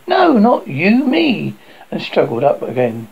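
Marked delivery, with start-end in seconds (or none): 0.1-1.5 higher, lilting acted outcry; insistent cry. A narrator's reading. Bobby struggling to go first, higher insistent cry. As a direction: cry it out in protest, with restraint. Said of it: "She only cried".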